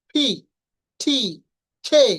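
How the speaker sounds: ফি, ঠি, খে with a strong puff of air; aspirated sounds. Shown as a demonstration. Teacher demonstrates aspirated consonants. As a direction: push air out on ফি, ঠি, খে. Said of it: "বাতাস সহ".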